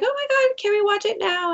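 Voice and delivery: put-on high, eager kids begging; excited kids. Kids begging the teacher, high and excited.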